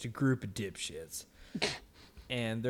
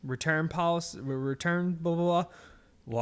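raised cashier voice; mocked cashier; 6 semitones higher, 5 dB louder than usual. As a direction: imitate the cashier asking, higher and a bit louder. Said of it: "and then she's like".

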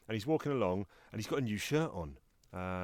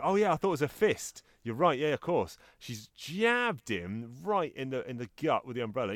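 ordinary delivery

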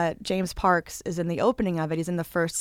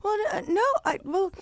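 high, hesitant begging; pleading mock whine; 12 semitones higher than usual. Retelling begging his friend to keep watching, mock whine. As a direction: beg like a kid denied a show. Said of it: "And I go".

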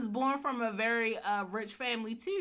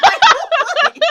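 laughing disbelief; 20 dB louder than usual. Real, not acted. Laughing disbelief at cheap car.

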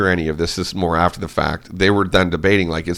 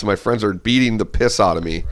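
ordinary delivery

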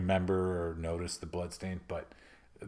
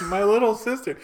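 laughing; 11 semitones higher, 6 dB louder than usual. Real, not acted. Laughing at sister detail.

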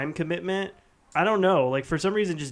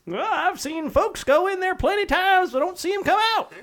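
put-on high, fast creaky old-timer voice; old prospector; 14 semitones higher, 3 dB louder than usual. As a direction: spin a spooky tale like an old miner. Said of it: "Can I be an old prospector".

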